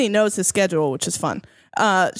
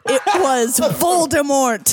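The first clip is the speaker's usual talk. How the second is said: slow, higher, laugh-tinged announcement; mock dramatic reveal; 5 semitones higher than usual. A narrator's reading. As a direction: draw out the name as a ridiculous big reveal. Reading out the big reveal.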